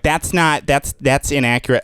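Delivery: lightly put-on fussy critic; a hint of a pedant's complaint; mock nitpicking viewer. Comic mimicking an at-home critic of his accents. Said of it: "somebody going at home like".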